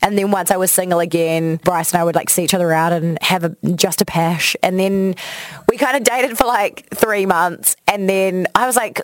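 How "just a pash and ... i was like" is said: ordinary delivery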